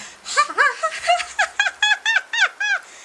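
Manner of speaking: high cackling witch laugh; shrill mock witch cackle. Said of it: "she was laughing like a witch".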